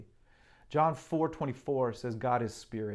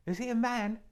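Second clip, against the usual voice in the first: put-on high, timid little girl voice; Lucy; 10 semitones higher than usual. Lucy asking Mister Beaver about Aslan, high and timid. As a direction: ask it like a small wondering child. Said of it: "asked Lucy".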